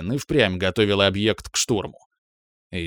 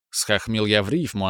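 ordinary delivery, nothing marked

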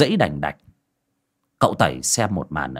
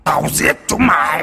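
higher, harsh possessed screech; screeched threat; 5 semitones higher, 4 dB louder than usual. A narrator's reading. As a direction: screech the death threat with full menace. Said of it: "mồm thì đầy bùn mà rít lên".

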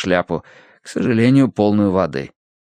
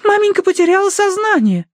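put-on very high girlish cry; Тосси exclaiming; 4 dB louder than usual. A narrator's reading. Daughter tells papa mama has fainted, high exclamation. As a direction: announce it in an alarmed, piping voice. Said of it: "воскликнула Тосси".

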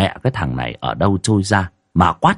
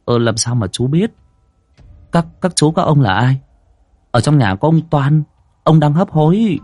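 higher, hesitant and taken aback; flustered stammering; 7 semitones higher than usual. A narrator's reading. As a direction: stumble over it, caught off guard. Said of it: "miệng ấp úng".

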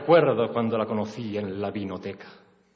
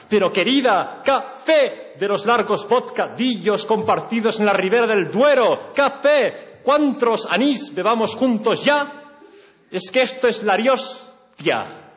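much higher and louder, carried away; loud excited plea; 11 semitones higher, 7 dB louder than usual. Acted. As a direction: rave at her over the engine. Excited pleading in the car.

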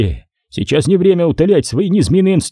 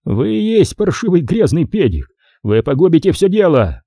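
higher-pitched angry accusation; heated insult; 6 semitones higher than usual. Acted. Nick accusing the major of ruining everything, mildly angry. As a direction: hurl it with a touch of outrage.

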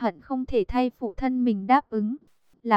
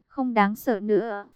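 ordinary delivery

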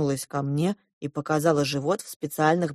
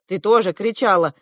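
ordinary delivery

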